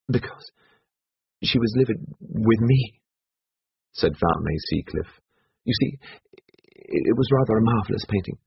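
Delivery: hesitant start, then explain in short bursts; lightly acted, pausing character voice; Seacliff, halting. Seacliff's voice in the story.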